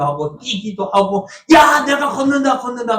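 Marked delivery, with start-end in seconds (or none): 1.5-3.0 high excited yell that fades at the end; joyful shouting. Retold or imitated. Healed man runs through the temple, shouting happily. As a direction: burst out again with joy, then ease off. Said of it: "하면서".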